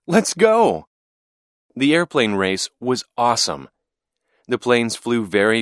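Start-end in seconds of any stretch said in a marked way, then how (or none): 0.1-0.9 high, eager call; excited urging. A narrator's reading. Boy hurries his dad to the airplane race, excited urging. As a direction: urge him out the door.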